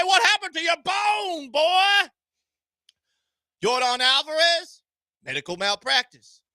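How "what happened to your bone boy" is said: mock Southern scout calling out. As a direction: bark it like a drawling scout. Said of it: "they're gonna look at that bone and say".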